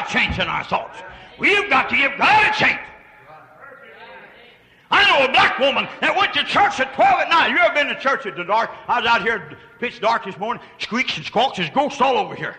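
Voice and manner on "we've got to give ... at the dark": high, loud preacher's cry; ringing sermon voice; preaching. Acted. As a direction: preach it out with full force. Preaching at full force.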